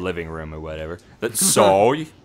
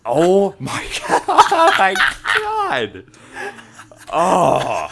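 helpless laughter; 10 semitones higher, 9 dB louder, much slower than usual. Real, not acted. Pranked by friend, losing it.

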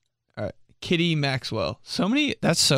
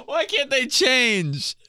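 high, drawn-out whine-scream; pleading high-pitched cry; exasperated wail; 11 semitones higher, slower than usual. Real, not acted. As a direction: wail it slowly in despair. Screaming about parents' mispronunciation.

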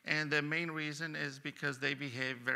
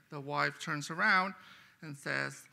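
ordinary talk

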